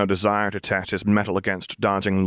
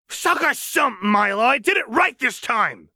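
roaring. Acted. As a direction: hurl it at the couch. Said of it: "she roared in Gutlow".

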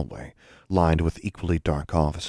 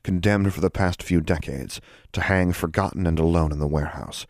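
ordinary delivery

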